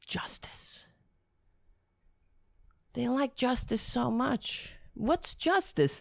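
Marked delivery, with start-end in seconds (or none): 0.1-0.9 hushed sarcasm. Real, not acted.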